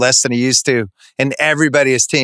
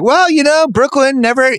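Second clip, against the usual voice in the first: pitched way up, lightly mocking a fan; 12 semitones higher than usual. Mocking fans of a weaker team.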